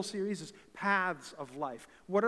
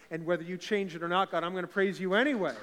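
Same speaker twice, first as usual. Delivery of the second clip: rising preaching fervor; 8 dB louder than usual. Real, not acted. Pastor lifting the church toward praise, building loud fervor. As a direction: build louder into the praise line.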